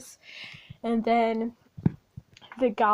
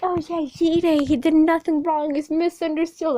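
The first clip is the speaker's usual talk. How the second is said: mocking fan voice; 4 semitones higher, 6 dB louder than usual. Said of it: "people are like".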